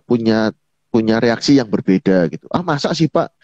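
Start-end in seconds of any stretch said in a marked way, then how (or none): 2.5-3.4 higher-pitched, mock sceptical question; judgmental questioning. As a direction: ask it as if passing judgment. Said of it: "lebih ke penghakiman kan".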